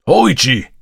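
loud, high grim shout; stern, forceful summons; grim call. A narrator's reading. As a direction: call the name harder, grimly. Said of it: "grimly called the voice a second time".

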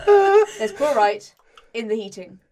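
slow, loud, each word spelled out; slow pointed accusation. Acted. Player confronting the kith, slow and loud. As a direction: lay it out slowly to the suspect.

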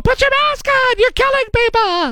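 hammed-up high Karen screech; screaming Karen. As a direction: shriek it like an outraged Karen. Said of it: "Karens screaming at people".

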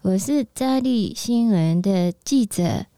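put-on slow Mandarin with a Japanese accent. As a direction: Japanese reporter speaking Mandarin, slowly. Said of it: "他比较厉害是模仿日本腔调".

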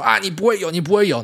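high-pitched mimicry of squabbling camps; mock bickering. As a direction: toss it out like rival fans sniping. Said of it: "然后互相那边喷".

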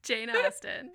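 loud laugh on the name; cracking up while saying it. Real, not acted. Co-host cracks up at premise.

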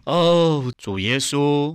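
high, loud, drawn-out cry to the Lord; loud shout. Retold or imitated. Forced to call on the Lord before onlookers, shouting loudly. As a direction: call it out for a crowd. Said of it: "大声喊".

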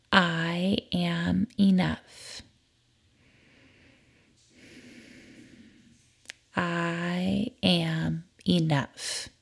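slow, soft, sincere affirmation. Acted. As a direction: say it slowly as if you mean it. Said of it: "I want you to say with me".

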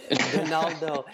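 Donaldo with Spanish vowels; Costa Rican Spanish accent. Acted. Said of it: "Say it with that, that accent".

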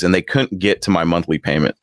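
ordinary delivery

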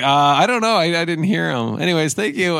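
lightly voiced embarrassed boy; flustered brother. Older brother covering for Johnny at the door, flustered.

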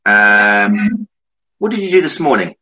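ordinary talk, nothing marked

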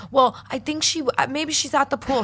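high, faltering kid impression; imitating terrified boy. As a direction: stammer a guess like a scared boy. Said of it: "visibly fucking terrified of me".

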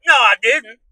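loud, high mock protest; indignant denial. Retelling his comeback to the girl, loud indignant denial.

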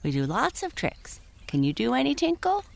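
high rising pony question; talking pony. Acted. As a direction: ask brightly like a friendly pony. Prancer voice.

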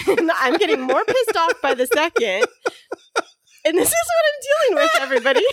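laughing through mock anger. Real, not acted. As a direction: fake pissed off, cracking up. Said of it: "Why are you laughing?".

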